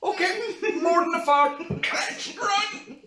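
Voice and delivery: high, urgent squeal with a laugh; mock panic. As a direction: squeal it like a sudden emergency. Acted panic of a near accident.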